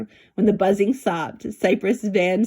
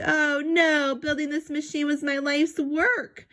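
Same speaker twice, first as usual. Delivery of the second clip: despairing; 8 semitones higher than usual. Acted. Professor despairs over wreckage.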